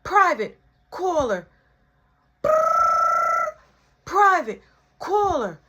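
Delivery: high, loud, slow caller ID voice; robotic phone announcing private caller; phone announcer voice. Retold or imitated. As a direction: mimic the phone announcing the call.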